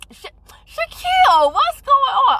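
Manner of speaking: thrilled to see a viewer join; excited greeting. Real, not acted. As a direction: call out the name delighted.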